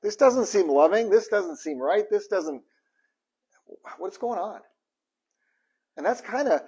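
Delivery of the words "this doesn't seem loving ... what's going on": high, loud, pleading why-God; a much higher, louder voice hurling doubt at God; questioning God. Retold or imitated. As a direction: cry out in baffled protest at God. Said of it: "You question".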